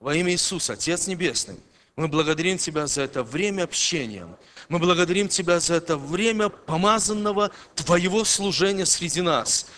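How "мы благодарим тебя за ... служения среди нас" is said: ordinary delivery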